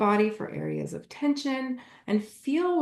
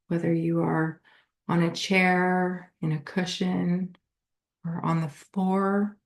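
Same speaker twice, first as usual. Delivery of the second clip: guided meditation; 3 semitones lower than usual. Acted. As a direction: lead them gently through a body scan, unhurried.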